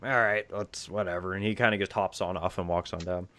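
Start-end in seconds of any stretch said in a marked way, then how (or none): 0.0-1.3 grudging gnome. Acted. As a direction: give in with weary indifference. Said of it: "The gnome kinda goes".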